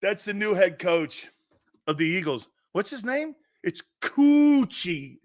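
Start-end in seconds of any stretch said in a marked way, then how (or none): 3.6-5.2 "It's Coochie" very slow, syllables drawn out; sounding it out. Shown as a demonstration. Host spells the coach's name by sound, very slow. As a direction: stretch "Coochie" out slowly. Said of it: "Sound it out".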